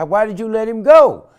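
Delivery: loud, high accusing cry; loud outraged question; angry shout. Retold or imitated. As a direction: shout the blame down the phone. Family calling, upset at friend.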